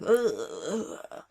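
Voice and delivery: guttural imitated noise in a crazy voice. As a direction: imitate his crazy voice with drawn-out wordless "ugh" sounds. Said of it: "had, like, a crazy ass voice".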